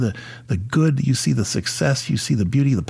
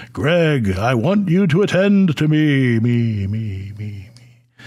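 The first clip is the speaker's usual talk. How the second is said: big voice of God; 2 semitones higher, 4 dB louder, slower than usual. Retold or imitated. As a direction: boom it out like God from the heavens, then let it die off. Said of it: "in that big voice saying".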